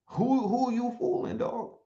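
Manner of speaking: ordinary delivery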